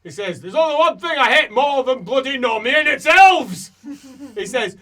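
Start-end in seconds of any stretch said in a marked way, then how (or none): 0.4-3.7 high, loud in-character bellow; furious rant. Acted. Character venting his hatred of elves, furious bellow. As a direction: build to a roar of disgust. Character rants about what he hates.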